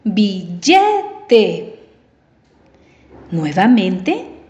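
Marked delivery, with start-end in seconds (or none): 0.0-1.8 "Billete" with weight on the middle syllable LLE; stress on second syllable. Shown as a demonstration. Teacher asking pupils to hear the stress, "Billete" stressed on LLE. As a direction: lean on LLE in "Billete", syllables set apart. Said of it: "Escucha dónde lleva la fuerza de voz".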